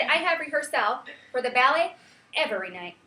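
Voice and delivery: "rehearsal" as re-her-SAL, "every" as e-VER-y; mis-stress "ballet" as BAL-ay and "every" as e-VER-y; wrong-syllable stress. Shown as a demonstration. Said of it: "But in that sentence, I said".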